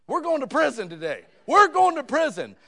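high, loud panicked cry; mock panic. A scared boy panics after laughing at the detective, in a high loud yell. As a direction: yell it like the kid's sure he's doomed. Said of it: "and I thought".

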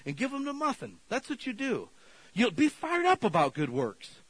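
high, loud, fired-up preaching; full-force preaching. Real, not acted. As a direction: preach it hard and lift the room.

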